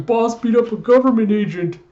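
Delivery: high, loud, squeaky underling voice; panicked assistant. Acted. Assistant shouting the news to the office, high and frantic. Assistant reports boss's fight.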